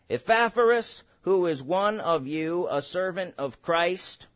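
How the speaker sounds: slow, measured Bible reading; scripture reading. Acted. Preacher reading the verse to the congregation, slow and measured. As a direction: read the verse slowly and deliberately.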